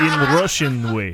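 hammed-up Russian movie villain; Russian villain accent. Adding to the requested villain line, mock Russian accent. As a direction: finish in a mock Russian bad-guy accent. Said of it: "as a Russian villain?".

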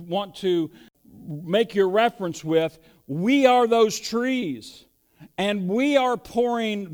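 rising sermon fervor; preaching. Acted. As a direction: drive the point home to the congregation. Preaching builds to full force.

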